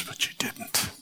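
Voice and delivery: a soft whisper, leaning in close; whispered question. Acted. Preacher questions the listener softly.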